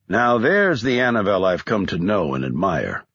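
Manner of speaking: plain reading voice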